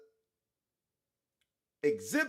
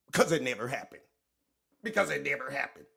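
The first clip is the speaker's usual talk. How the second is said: low, mock-solemn lawyer voice; 8 semitones lower than usual. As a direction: parrot the lawyer in a low pompous tone. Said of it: "Look at this stupid ass lawyer".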